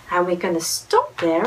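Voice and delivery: ordinary delivery, nothing marked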